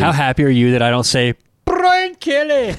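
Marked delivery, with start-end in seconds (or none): 1.6-2.8 high, drawn-out holler of a name; playful over-the-top name call; mock hollered name. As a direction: belt out the name, stretched and exaggerated. Mock announcer-style yell of coach's name.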